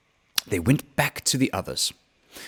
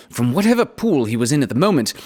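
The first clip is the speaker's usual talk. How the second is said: plain reading voice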